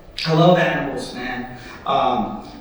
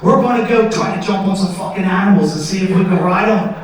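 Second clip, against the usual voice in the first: put-on drunk Australian guy. Comic doing a drunk Aussie daredevil bit for the crowd, rowdy Australian accent. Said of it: "Just some drunk Australian guy who goes".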